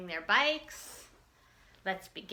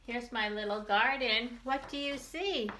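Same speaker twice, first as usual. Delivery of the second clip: normal talking voice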